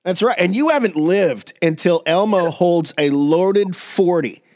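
usual speaking voice

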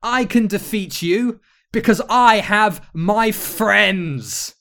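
high, loud, stretched for grandeur; hammed-up rallying cry; heroic declaration. A narrator's reading. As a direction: proclaim it like a storybook hero. Hero rallies against the witch.

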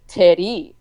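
put-on "Teddy" standing in for three, in mom's accent; mispronounced three. Daughter imitating mom attempting three, accented "Teddy". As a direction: say "Teddy" as an Iranian mother's attempt at three, tapped r. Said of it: "say three".

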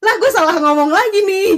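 high-pitched mock bafflement, laugh close by; laughing disbelief. Retelling a quarrel to a friend, amused disbelief. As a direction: replay your baffled reaction, amused.

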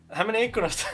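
high-pitched mock call-out; acted shout. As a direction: call it out high, faking the alarm. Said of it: "huudan, huudan". (leikisti huudahtaen korkealla äänellä)

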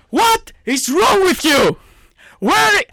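high, drawn-out exasperated shout; shouted rant. Real, not acted. Football fan ranting at their losing team, shouting. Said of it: "the thing that I wanna shout about".

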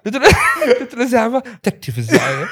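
breaking into laughter while quoting; high laughing quote; laughing retelling. Retold or imitated. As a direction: giggle through the words. Retelling talking to his son.

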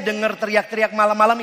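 usual speaking voice

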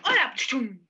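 street thug greeting. Pretend. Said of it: "te digan".

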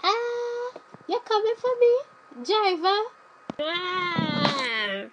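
high pretend call. Pretend hailing limo driver.

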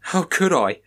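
panicked voice rising out of control; crying hysterically. Acted. Samson to the officer, hysterical panicked cry. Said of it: "Samson cried hysterically".

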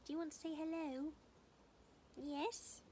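high, quick sing-song pet voice. Real, not acted. House sitter cooing to the little dog Teddy, high baby talk.

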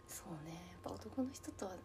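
whispering. Real, not acted. Whispered confiding aside.

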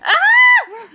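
sudden high, loud scream. Pretend. Ending a scary rhyme by jumping at friends, piercing scream. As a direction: scream to make a friend jump. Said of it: "Jump at your friends and scream".

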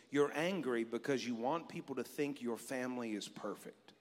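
low, soft inner voice; quiet voice of God. Retold or imitated. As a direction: speak it gently as God would. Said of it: "inward voice of God say this to me".

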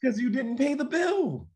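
squeaky high pretend voice; high put-on voice. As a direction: answer in a high, loud squeak. Role-play over unpaid water bill.

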